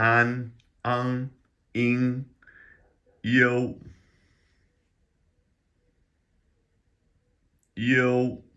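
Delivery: An, ang, ing, yo held high and level; first-tone finals. Shown as a demonstration. Teacher sounding out finals for learners, high flat tone. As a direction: chant each final on one high pitch. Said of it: "let's just rip through these in the first tone".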